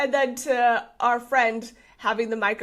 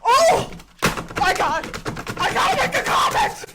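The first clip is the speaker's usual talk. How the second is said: hammed-up shouting, pitched way up; mock frenzied commenter; 5 semitones higher than usual. Host imitating angry commenters who must prove them wrong, mock shouting. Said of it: "I'm not like".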